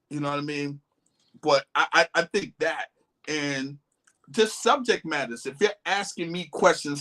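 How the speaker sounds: usual speaking voice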